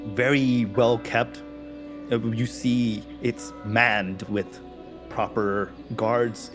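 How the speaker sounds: usual speaking voice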